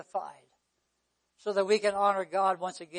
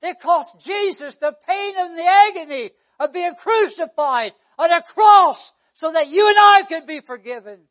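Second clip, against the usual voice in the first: loud, raised-pitch pulpit preaching; 7 semitones higher, 15 dB louder than usual. Real, not acted. Preacher telling congregation of the crucifixion, preaching at full force.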